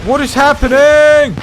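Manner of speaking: high, loud, drawn-out disbelief; stunned shout mid-fight; shocked yell. Real, not acted. Chaotic firefight, shocked yell.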